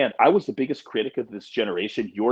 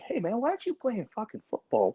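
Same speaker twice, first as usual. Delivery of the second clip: high-pitched, softer mock disbelief; puzzled coach's question, re-enacted; retold incredulous question; 9 semitones higher, 7 dB quieter than usual. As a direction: ask it high and baffled, imitating yourself. Retells his question to the kids.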